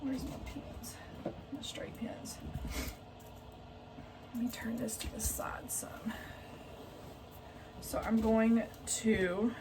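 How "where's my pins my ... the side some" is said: whisper. Real, not acted. Searching for pins mid-sewing.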